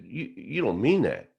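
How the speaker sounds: imitated nervous check, pitch jumping up. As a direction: ask it nervously, stumbling on the first word. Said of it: "Nervously, I looked at him".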